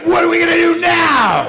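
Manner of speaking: bursting with caffeine energy; hyped shout. Pretend. Describing morning coffee rush to friends, hyped shout.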